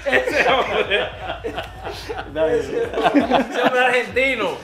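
slow words squeezed out through laughter; laughing. Real, not acted. Teasing the guest while laughing, drawn out.

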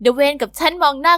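ordinary delivery

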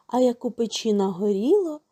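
ordinary delivery

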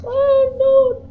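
high frightened cry; scared cry. Real, not acted. Jump scared by mannequin.

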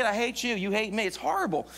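ordinary talk, nothing marked

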